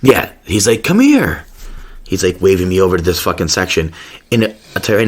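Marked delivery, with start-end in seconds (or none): 0.8-1.4 beckoning call. Retold or imitated. Pharmacy guy waving him over.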